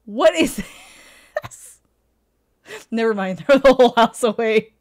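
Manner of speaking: incredulous laughing outburst. Real, not acted. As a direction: cackle through it. Laughing disbelief.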